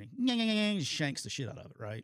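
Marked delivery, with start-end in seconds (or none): 0.2-0.8 high rapid nasal-sound babble; imitated "nyah nyah" run; mimicked nyah-nyah noise. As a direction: rattle off a mock noise, pitched way up. Imitating action before a shanked kick.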